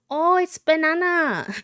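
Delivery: high, loud teasing call of banana; teasing landlord. Retold or imitated. Landlord teasing young B about her name, loud and high. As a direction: sing out the name wrong to tease a kid. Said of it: "he had an accent".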